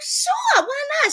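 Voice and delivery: high, loud eager mock reply; excited yes. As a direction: squeal an eager yes to her friend. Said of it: "I was like".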